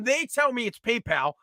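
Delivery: ordinary delivery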